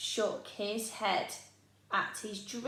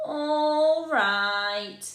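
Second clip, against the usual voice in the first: sighing; 5 semitones higher, 7 dB louder than usual. Acted. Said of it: "sighed Oliver".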